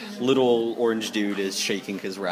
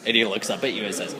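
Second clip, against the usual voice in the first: usual speaking voice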